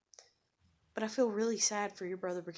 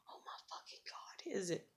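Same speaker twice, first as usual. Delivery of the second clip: barely audible, voiceless whisper; whispered exasperation; 14 dB quieter than usual. Real, not acted. Woman venting about her ex to herself, whispered. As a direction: whisper it under your breath. Muttering to self between rants.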